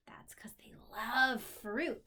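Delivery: slow hushed whisper. Real, not acted. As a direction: tell it as a secret. Whispered aside to child.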